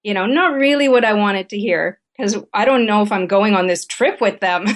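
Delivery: ordinary talk, nothing marked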